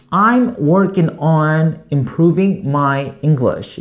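very slow, careful English demo; slow model phrase. Shown as a demonstration. Teacher models the phrase slowly for Korean students. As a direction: spell it out slowly for learners. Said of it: "영어로 어떻게 한다?".

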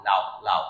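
লাউ with the উ quick, one syllable; লাউ said low, উ gliding fast; quick glided উ. Shown as a demonstration. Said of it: "রস্য যেটা আছে সেটা তাড়াতাড়ি বলতেছি". (লাউ-এর উ দ্রুত)

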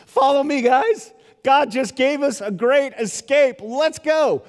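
high, loud and eager; rally the prisoners to flee; excited escape call. Pretend. Paul urging fellow prisoners out, excited. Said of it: "Paul had every opportunity to say".

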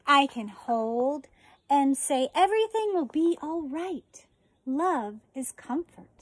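slow, gentle storybook read; reading to children. Acted. Teacher reading a book on compassion to her fourth graders, gentle read-aloud. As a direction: read it tenderly to the class, pausing often.

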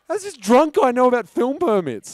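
high-pitched baffled disbelief; incredulous. Retold or imitated. Film crew member baffled by drunk's permit demand, incredulous.

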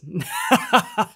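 short high-pitched chuckle; amused laugh at your own joke. Real, not acted.

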